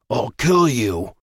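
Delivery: slow, menacing threat in a strange voice. Acted. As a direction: growl the threat slowly. Remus attacks Atticus in rage.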